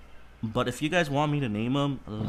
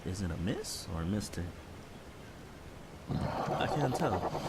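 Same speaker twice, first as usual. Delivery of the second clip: normal talking voice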